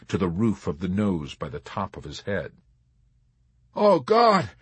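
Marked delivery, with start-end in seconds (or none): 3.8-4.5 high, loud cry of terror; terrified shriek. A narrator's reading. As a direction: shriek it as you feel yourself falling. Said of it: "had shrieked soundlessly".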